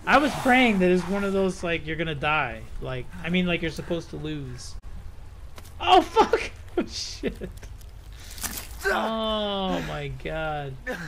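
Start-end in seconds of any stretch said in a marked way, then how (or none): 5.8-7.5 startled yelp. Real, not acted. Sudden scare in game.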